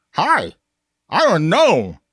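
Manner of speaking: high, loud, mouth-open gnome with a laugh. Shown as a demonstration. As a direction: squeak it out with your jaw hanging open. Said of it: "you can hold your mouth open".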